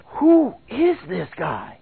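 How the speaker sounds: slow, drawn-out amazement; astonished, deliberately slow question; marveling. Acted. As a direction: stretch each word in acted disbelief. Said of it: "it says that they marveled".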